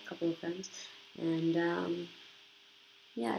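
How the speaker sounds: normal talking voice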